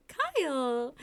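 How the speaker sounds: loud, drawn-out happy call; excited shout. A narrator's reading. Guest sees Kyle walk in, excited shout. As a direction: call out to a friend in delight. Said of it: "you shout excitedly".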